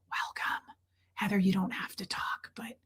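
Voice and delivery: soft gentle whisper. Shown as a demonstration. Streamer whispering to a shy quiet viewer, soft whisper. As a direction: hush it so you don't scare her. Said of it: "even lowering my voice for her".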